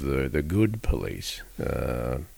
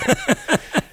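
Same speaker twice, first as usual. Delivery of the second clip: short high burst of laughter; delighted little laugh. Real, not acted. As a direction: laugh at the coincidence. Laughs at the coincidence.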